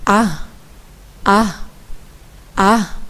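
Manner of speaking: sukun letter ah pronounced three times, voiced from the throat base; throat sound demo. Shown as a demonstration. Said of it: "huruf H kita baca dengan".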